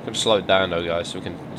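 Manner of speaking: ordinary talk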